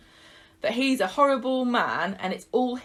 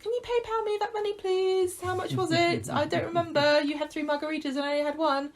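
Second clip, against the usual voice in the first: high-pitched, quick mimicked money nagging; imitating a petty friend, pitched right up; mock pestering friend; 9 semitones higher than usual. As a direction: chase up a debt in a high put-on voice. Acting out friends chasing money afterwards.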